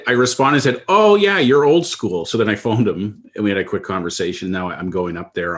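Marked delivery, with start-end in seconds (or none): 0.9-2.2 high, loud playful comeback; teasing retort. Retold or imitated. Texting back the ex-boss, teasing. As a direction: exclaim it in mock realization, high and loud.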